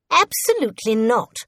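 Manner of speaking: high-pitched imperious refusal; sharp retort. A narrator's reading. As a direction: retort like an affronted queen. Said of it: "retorted the queen".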